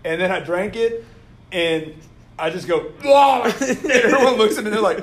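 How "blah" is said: loud, high "blah" of disgust; reenacted gag at a nasty taste; disgusted blurt. Drinker reacting to the awful soda, loudly disgusted.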